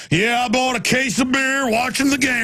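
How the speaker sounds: mock the dumb jock bragging; mocking beer guy. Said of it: "all the men are like".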